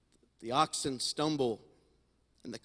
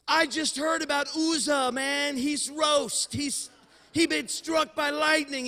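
high, disbelieving panicked voice; incredulous high-pitched panic; Obed-Edom freaking out; 13 semitones higher, 4 dB louder than usual. Retold or imitated. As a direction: squeal it like a terrified man who doesn't want the thing. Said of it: "I'm thinking Obed-Edom is like".

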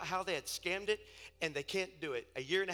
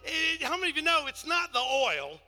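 high preaching voice building to loud shouts; fervent preaching; 6 semitones higher, 8 dB louder than usual. Real, not acted. Preacher testifying to the congregation about healing oil, fervent and building. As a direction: preach it with mounting force.

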